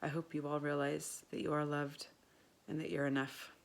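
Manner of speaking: low, soft, earnest closing words; heartfelt sincere. Real, not acted. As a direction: speak a quiet, sincere blessing to listeners. Heartfelt closing prayer and blessing.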